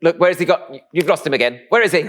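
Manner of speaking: high, loud mock panic; exasperated mum. Mum blaming dad for losing son, exasperated. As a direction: scold and search, pitch and volume up. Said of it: "turning to my dad would be like".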